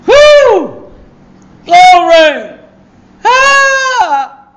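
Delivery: very high, loud cries of joy; ecstatic shouts. Real, not acted. Preacher carried away by the Spirit, shouting. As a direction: burst out with real exultation. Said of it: "Just getting excited".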